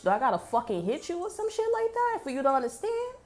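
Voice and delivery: high-pitched, incredulous rhetorical question; exasperated challenge. Retold or imitated. Acted confrontation, exasperated rhetorical question.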